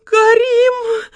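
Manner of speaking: высокий громкий вопль; закричать тонким чужим голосом; крик тонким голосом. A narrator's reading. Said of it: "тонким незнакомым голосом закричала". (тонкий испуганный крик)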